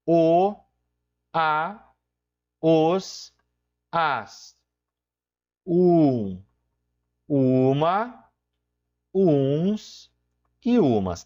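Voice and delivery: isolated articles with a pause after each one; slow enumeration. Shown as a demonstration. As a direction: recite the article forms one at a time. Enumera os artigos em português.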